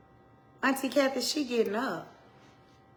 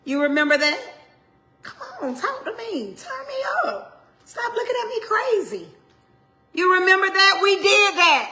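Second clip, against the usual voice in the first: much higher, loud teasing reproach; high excited scolding; 10 semitones higher, 8 dB louder than usual. Acted. Preacher teasing listeners, high and loud excited reproach. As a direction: shriek it like catching them out.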